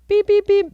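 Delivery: high, thin beeping; imitated monitor beeps. Hospital monitor beeping by grandma's bed, high imitation. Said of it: "the beeping in the back's going".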